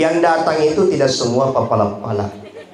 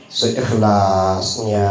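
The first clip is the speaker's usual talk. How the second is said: very slow, low drawn-out answer; imitating the odd collector; donation-seeker's drone; 6 semitones lower, much slower than usual. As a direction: stretch it out on a low monotone. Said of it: "Apa dia bilang?".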